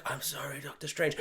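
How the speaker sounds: near-silent mock regret; hushed apology. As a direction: breathe it out gently. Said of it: "And he's like".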